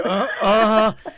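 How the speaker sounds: exaggerated imitation of her uh-huh; mimicked uh-huh. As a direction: mockingly echo the caller, slow. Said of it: "She's like".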